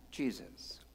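hushed, reverent whisper; whispered name. Real, not acted. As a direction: breathe the name in awe. Awed pause on the name.

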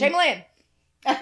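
"Jamie Lynn" drawn out as one word, Jamalynn; grandfather's run-together name. Retold or imitated. Grandfather calling her, "Jamie Lynn" stretched into one word. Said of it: "he was like, Jamie Lynn".

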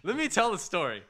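insistent protest. Real, not acted. Storyteller to teasing friends who interrupt, insistent protest. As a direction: push back over the interruption.

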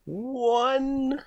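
high, drawn-out cry; mock wail. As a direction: wail the bad roll, long and high. Lamenting a poor dice roll.

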